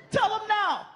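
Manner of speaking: high, indignant mock girlfriend; a high shrill-voiced mock mom; angry girlfriend. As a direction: scold like a protective mother. Girlfriend demanding.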